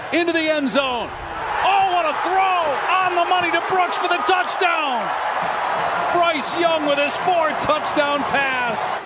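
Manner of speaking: rising excited play-by-play shout; touchdown call. Real, not acted. Announcer calls a touchdown.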